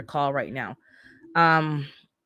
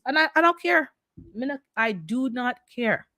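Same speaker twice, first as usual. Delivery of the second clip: defiant; 6 semitones higher than usual. Pretend. Defiant mock voice again.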